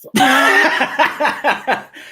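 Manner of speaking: high burst of laughter; loud laugh. Real, not acted. Host laughs at joke.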